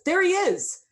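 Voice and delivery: high, loud cry of spotting someone; shout from the crowd. A narrator's reading. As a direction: call out across a crowd. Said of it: "there is a shout".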